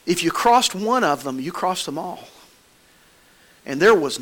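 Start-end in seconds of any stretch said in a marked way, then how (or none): none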